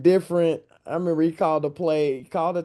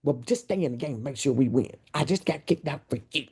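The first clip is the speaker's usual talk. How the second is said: put-on Van's accent; 5 dB quieter than usual. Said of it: "his accent was".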